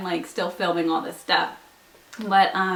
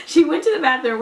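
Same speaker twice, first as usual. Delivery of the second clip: laughing; 7 semitones higher, 4 dB louder than usual. Real, not acted. Laughing while retelling.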